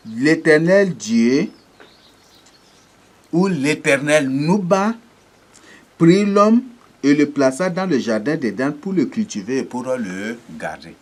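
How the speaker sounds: preacher declaiming scripture; solemn scripture reading. Acted. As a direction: proclaim the Bible verse to the congregation. Reads Genesis aloud.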